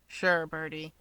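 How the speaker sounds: fed-up dismissal. Acted. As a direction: brush him off, bored. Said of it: "completely over it".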